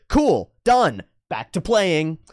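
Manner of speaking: put-on high, punchy kid signing off; carefree kid. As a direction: call it out like a child dashing off. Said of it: "then the kid goes".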